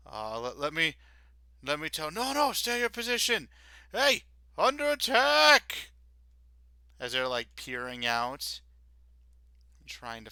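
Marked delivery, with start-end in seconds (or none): 2.1-5.9 high, loud alarm call; guard calling out. Acted. Guard calling an alarm to the others. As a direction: shout the warning across the camp.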